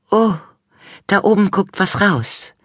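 high sweet wife voice; Frau Gruber. A narrator's reading. Wife pointing out a long grass blade, high voice. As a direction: lift the voice high and sugary. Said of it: "sagte Frau Gruber manchmal".

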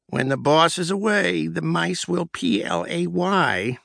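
a hint of a scornful snort, letters drawn out; snorting remark. Sister snorts at the partying office, mildly scornful. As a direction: sneer the proverb, slow. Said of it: "she snorted".

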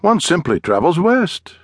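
high, breezy English gentleman; English accent. A narrator's reading. Englishman shrugging off the guide question, in a high English accent. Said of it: "spoke in a strange accent".

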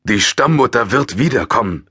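voiced older man, stern. A narrator's reading. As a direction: put her in her place. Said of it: "erklärte der ältere Mann".

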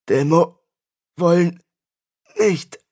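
broken words, high and strained; dying gasps. A narrator's reading. Dying priest struggles to answer Tristan, gasping. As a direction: force out one word at a time.

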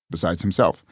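ordinary delivery, nothing marked

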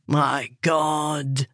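a slight groan in character. A narrator's reading. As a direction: groan it out with restraint. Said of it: "Billy T groaned".

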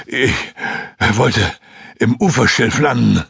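words broken by gasps for air; out of breath from swimming; panting. Acted. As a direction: pant it out word by word. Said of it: "keuchte Joskun".

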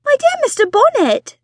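high, loud, foot-stamping reproach; exasperated wife. Acted. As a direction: scold him like a frustrated wife. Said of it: "replied his wife, stamping her foot".

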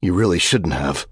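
ordinary delivery, nothing marked

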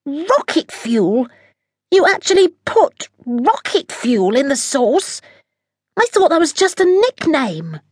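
can't believe what he just ate; captain aghast at the recipe; horrified disbelief. Acted. Harvey alarmed after eating.

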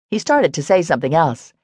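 plain reading voice

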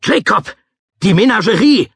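very high, alarmed yell; high-pitched cry of alarm; urgent shout. Acted. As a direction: shout a warning across the lot. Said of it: "rief er".

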